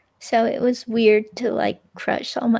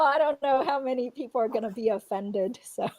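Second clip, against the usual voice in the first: ordinary talk, nothing marked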